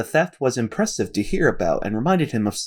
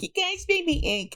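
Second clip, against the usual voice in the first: high, soft cartoon squid voice; 4 dB quieter than usual. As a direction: squeak it out like a sad squid. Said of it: "I referenced Finding Nemo being like".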